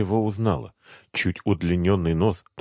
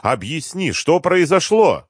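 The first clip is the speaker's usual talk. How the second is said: high puzzled question, drawn out; недоумённо; 10 semitones higher, 4 dB louder, slower than usual. A narrator's reading. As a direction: press for an explanation, rising high and slowing. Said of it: "с недоумением спрашивали". (растерянный, настойчивый вопрос)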